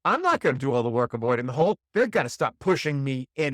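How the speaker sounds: high, loud hammed-up protest that drops back down; mock outrage, loud, high at first then speeding up; mock blaming. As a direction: keep acting the indignant blamer. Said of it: "is what we say".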